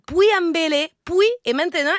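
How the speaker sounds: put-on Lola's thick Spanish accent, stumbling on French names. (accent espagnol appuyé, bute sur les noms français)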